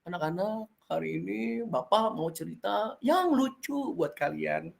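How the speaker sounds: teacher showing a sad voice for a funny story. Shown as a demonstration. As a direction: promise a funny story in a glum tone. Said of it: "Tapi mukanya sedih".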